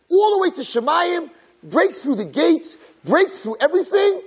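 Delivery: very high, soaring narration; dramatic crescendo. A narrator's reading. Narrator follows the scream up to heaven, impassioned. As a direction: cry it out as if piercing the heavens.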